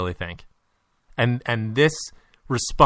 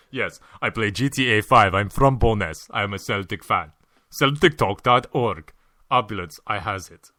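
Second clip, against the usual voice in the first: mock Russian Gaffer; 3 semitones lower than usual. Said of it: "that's a Wenger".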